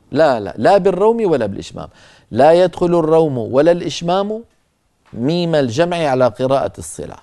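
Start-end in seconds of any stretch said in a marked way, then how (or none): none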